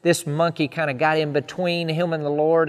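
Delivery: usual speaking voice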